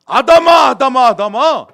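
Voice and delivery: very loud, high-pitched summons; booming repeated cry of a name; God calling out. Acted. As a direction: call urgently for someone in hiding.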